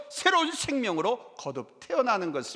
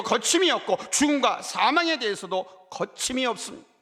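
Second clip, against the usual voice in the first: louder, rising preaching build; 힘차게 선포하듯; 4 semitones higher, 3 dB louder than usual. Acted. Preaching climax. (열정적으로 설교하듯)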